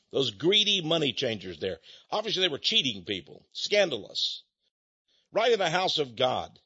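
normal talking voice

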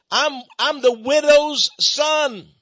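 high, loud call, stretched out at the end; imitated cry for help. The preacher imitates Masons calling for help, high loud cry. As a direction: call it out like a man seeking rescue. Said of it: "told to cry out".

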